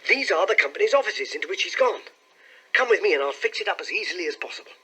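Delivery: high, eager, hurrying them along; excited. A narrator's reading. Clerk leading companions to the offices, excited. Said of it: "cried Hall Pycroft".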